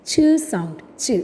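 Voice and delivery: isolated "chuh" for ch, not spelt out; ch sound. Shown as a demonstration. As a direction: say ch as a short "chuh". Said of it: "Next sound we are going to study". (ch എന്നത് "ച്" ശബ്ദമായി)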